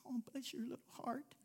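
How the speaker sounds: whispered "bless your little heart"; hushed, syrupy whisper; pitying whisper. Retold or imitated. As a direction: breathe it out in mock pity. Mock sympathetic response.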